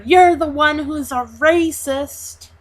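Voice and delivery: old man shouting back at the bus driver, mild mock yell; retold scream back. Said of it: "screams back at her".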